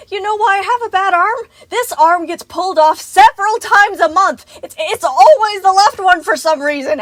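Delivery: very high, shouted frantic tirade; unhinged rant. A narrator's reading. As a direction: hysterical exasperation at full volume. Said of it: "ranting on and on".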